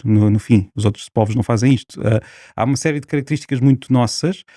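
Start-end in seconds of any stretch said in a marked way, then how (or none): none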